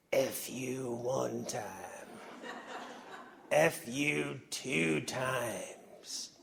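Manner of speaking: Charles Brewer's southern drawl. Retold or imitated. Fond imitation of a mentor leading the cheer in a southern drawl. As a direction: stretch every word in a lazy southern drawl. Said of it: "to hear him say it in his southern drawl".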